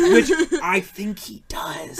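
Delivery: loud, high and drawn out through laughter; laughing outburst. Real, not acted. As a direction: crack up while insisting. Laughing through a comic aside.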